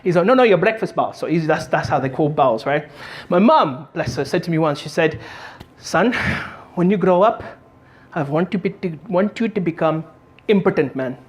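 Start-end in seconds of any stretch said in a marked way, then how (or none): 0.3-1.1 lightly imitated Indian dad; dad explaining, a hint of Indian accent. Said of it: "who speak like that". 5.8-11.2 imitated Indian mum; halting, hopeful mother with an Indian accent. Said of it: "who speak like that".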